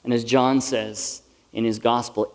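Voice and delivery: usual speaking voice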